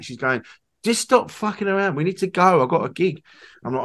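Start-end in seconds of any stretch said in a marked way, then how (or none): 0.8-3.2 impatient nag. Retold or imitated. Impatient quoted wife, higher.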